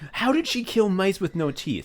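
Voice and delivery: ordinary delivery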